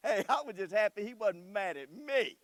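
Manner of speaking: usual speaking voice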